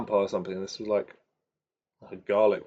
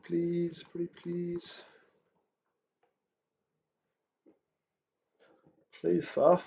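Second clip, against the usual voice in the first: higher, pleading, stretched pretty please; mock begging; 6 semitones higher, much slower than usual. Real, not acted. Trader jokingly begs the market to let him long, higher.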